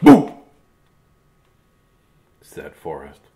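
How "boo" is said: sudden high, loud boo; short burst to spook someone; playful scare. Acted. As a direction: jump out and shout it to startle. Character trying to scare away hiccups.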